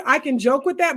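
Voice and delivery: ordinary talk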